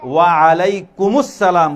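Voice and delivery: ওয়া আলাইকুমুস সালাম slowly, long vowels held; clear, correct pronunciation. Shown as a demonstration. Teacher leads the class in answering salam, slow clear pronunciation. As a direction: lead a chorus in ওয়া আলাইকুমুস সালাম, drawn out. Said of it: "স্পষ্ট শুদ্ধ উচ্চারণে".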